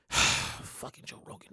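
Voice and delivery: exasperated whisper under the breath; a fed-up whispered curse after a sigh. Real, not acted. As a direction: hiss the name in frustration.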